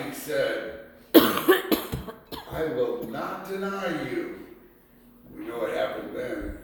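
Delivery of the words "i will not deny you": very slow, drawn-out vow; solemn vow. Acted. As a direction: lay each word down with stubborn conviction. Peter's vow of loyalty.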